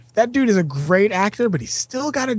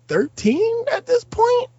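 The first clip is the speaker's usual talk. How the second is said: incredulous; much slower than usual. Real, not acted. Incredulous about age.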